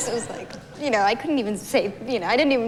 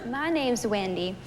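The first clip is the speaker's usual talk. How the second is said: prank caller voice; 2 semitones higher than usual. Retold or imitated. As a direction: sell it like a fake carpet company caller. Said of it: "I used to say".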